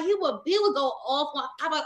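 ordinary talk, nothing marked

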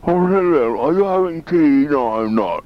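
high, loud, hammed-up posh English accent; posh British accent, played for laughs; posh British mock. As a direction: lampoon a prim English tea party in a posh British accent. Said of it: "they made a Downton Abbey".

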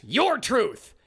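YOUR and TRUTH both punched; all-caps emphasis. Acted. Host quoting an all-caps article, both YOUR and TRUTH stressed. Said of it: "in all caps".

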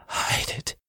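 strained voiceless last words; dying man's whisper. Acted. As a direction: whisper it with failing breath. Dying man struggles to form words.

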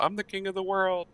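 a quick, lightly voiced movie quote; Titanic king of the world. Man on the ship's bow quoting Titanic, lightly voiced. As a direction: toss off the famous Titanic line. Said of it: "kind of like doing the".

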